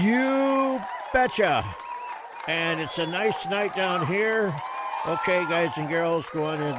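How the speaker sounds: loud, high, slow shout of agreement; hearty shout. Real, not acted. Host answering his guest's good morning with a hearty shout. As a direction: boom it out happily.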